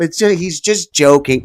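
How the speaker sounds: lightly voiced sarcastic apologist brushing it off. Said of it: "I've been doing a little [name] impression".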